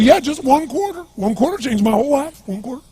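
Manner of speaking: put-on high, strange drunk beggar voice; bizarre voice. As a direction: pitch it way up like the odd drunk. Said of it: "He had this really bizarre looking sounding voice".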